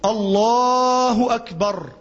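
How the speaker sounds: "الله أكبر" stretched slowly, both words long; slowly drawn out. Shown as a demonstration. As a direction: draw out "الله أكبر" at an unhurried pace. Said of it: "أو".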